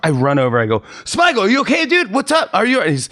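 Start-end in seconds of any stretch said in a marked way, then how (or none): 1.0-2.8 high, alarmed call down into the pit; worried calling out. Retold or imitated. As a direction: call out anxiously to a hurt friend. Calling to fallen friend.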